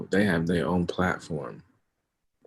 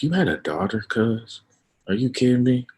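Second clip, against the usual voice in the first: imagined parental outrage, pitched up high; indignant disbelief; 7 semitones higher, 4 dB louder than usual. Pretend. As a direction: protest in disbelief, voice climbing. Imagining his own daughter exposed to this.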